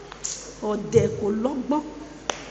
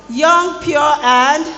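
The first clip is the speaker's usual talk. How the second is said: put-on higher, louder call-out of "young, pure, and"; 4 semitones higher, 8 dB louder, much slower than usual. Shown as a demonstration. Preacher urging the hall to answer, higher call. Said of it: "are there people in this hall".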